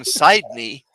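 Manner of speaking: Sidney with a long I, SIDE-nee. Real, not acted. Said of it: "Oh, I like that. Sidney".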